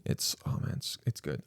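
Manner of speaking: whispered savoring. Real, not acted. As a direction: breathe it out after a sip. Sipping coffee.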